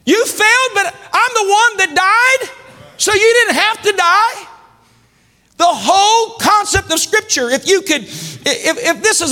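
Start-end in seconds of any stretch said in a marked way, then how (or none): 0.0-4.5 a higher, lightly voiced God, gently correcting. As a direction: speak as God answering the sinner with mercy, pitch lifted. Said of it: "He said".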